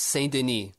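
"Saint Denis" ending in a nee sound, s silent; corrected pronunciation. Shown as a demonstration. Host correcting co-host's name reading, "Saint Denis" as Saint Deh-nee. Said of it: "Not Den-, Dennis".

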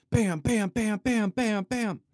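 high, loud staccato bams; punchy rapid-fire hits. Shown as a demonstration. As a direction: mimic hyper short-form content. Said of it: "the one minute content's just like".

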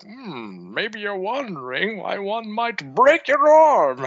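plummy British threat, slow; mock posh British. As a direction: Brock turned refined English villain. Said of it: "if he starts talking like".

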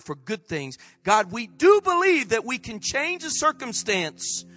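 emphatic, swelling declarations to God; fervent public prayer. Real, not acted. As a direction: pray out with rising force and conviction. Leads congregational prayer.

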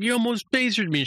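panicked yelp. Retold or imitated. Boimler squeals at Mariner after nearly being phasered, high and panicked. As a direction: squeal it out like he nearly got shot.

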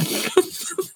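a sudden breathy unvoiced laugh; burst of laughter. Real, not acted. Laughing at the five-year-old's comeback, a breathy burst.

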